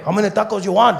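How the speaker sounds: a casual street vendor taking an order; a high, loud question through the truck window; taco guy. Retold or imitated. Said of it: "It's okay".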